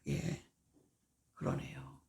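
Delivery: quiet, submissive reply; 그러네요 said softly, under the breath; swallowed reply. Shown as a demonstration. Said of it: "그거를 죽이는 거야". (속으로 삭이며 조용히 대답)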